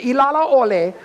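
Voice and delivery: high and loud, blurting the name; excited recognition. Retold or imitated. Teller recognizes the chanter's name, loud and thrilled. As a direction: burst out with it in amazement.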